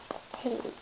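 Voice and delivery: ordinary delivery, nothing marked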